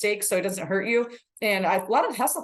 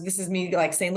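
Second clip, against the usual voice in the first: ordinary talk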